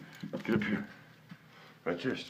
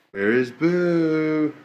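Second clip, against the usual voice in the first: 1 sing-song call; 7 semitones higher, 8 dB louder, much slower than usual. Real, not acted. Calling for the cat.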